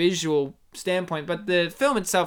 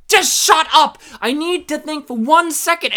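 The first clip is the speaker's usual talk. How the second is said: loud, higher mock-angry shout; exasperated outburst; 7 semitones higher, 7 dB louder than usual. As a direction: imitate a man yelling at his interrupter. Acts out interrupted man snapping back.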